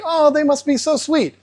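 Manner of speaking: high, sweet naive gush. Retold or imitated. New fish owner gushing over sweet angelfish, naive. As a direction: sound dewy-eyed about angelfish. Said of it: "So you think".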